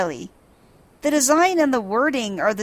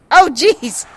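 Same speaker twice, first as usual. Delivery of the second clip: higher, louder startled oh jeez; 7 semitones higher, 8 dB louder than usual. Real, not acted. Player reacting to the flying card, startled and louder. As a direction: jolt at the near miss. Card flies past characters.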